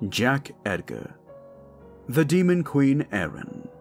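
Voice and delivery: ordinary talk, nothing marked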